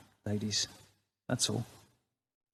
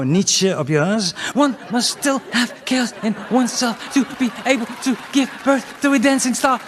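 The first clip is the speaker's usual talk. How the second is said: lightly voiced woman; 7 semitones higher than usual. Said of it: "Ever had a woman go".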